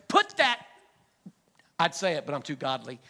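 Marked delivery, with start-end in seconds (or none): 0.1-0.6 a sharp mock yell, louder and higher; angry yell at kids. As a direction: snap at the girls fighting in the back seat. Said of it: "She turns around and says".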